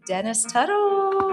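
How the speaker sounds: drawn-out, high announcer call of the name; stage introduction. Real, not acted. Host welcoming the speaker to the stage, slow and high announcer call. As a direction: call the guest up like a ring announcer.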